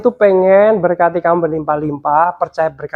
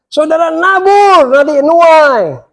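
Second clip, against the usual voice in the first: much higher, louder, slow hammed-up preacher voice; mimicking preachers; 11 semitones higher, 5 dB louder, slower than usual. Preacher mocking other pastors' sowing-and-reaping line, high hammed-up preaching. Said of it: "Kadang pendeta itu ya, ngajarin apa?".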